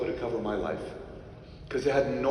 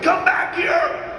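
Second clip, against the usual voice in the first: high, loud, slow shout; yelled, drawn out; 9 dB louder, much slower than usual. Retold or imitated. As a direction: stretch the call to bring them back. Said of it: "She was like".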